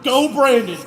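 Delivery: high, loud spectator yell; re-enacted cheer. Spectator retells urging on the new leader, cheering loudly. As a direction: cheer the driver on at full volume. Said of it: "we're just like".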